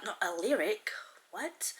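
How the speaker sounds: a quick whispered self-correction under the breath; muttered whisper to herself; whispered aside. Real, not acted. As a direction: drop to a whisper to fix the slip.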